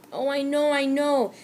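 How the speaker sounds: high, woeful admission; ashamed and sorry. A narrator's reading. Remorseful boy admits his fault, woeful and high. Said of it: "John admitted woefully".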